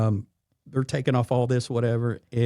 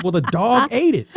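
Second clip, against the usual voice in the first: sheepish joker; 10 semitones higher, 5 dB louder than usual. Retold or imitated. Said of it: "He was like".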